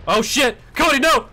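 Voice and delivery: sudden panicked yell; alarmed shout. Real, not acted. Teammate nearly killed by friendly fire.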